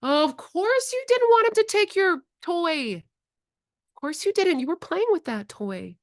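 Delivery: put-on high, slow, tender reassurance to a child; gentle sing-song comfort; soothing parent. Shown as a demonstration. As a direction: soothe a toddler over a toy. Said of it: "You can say it to children out loud".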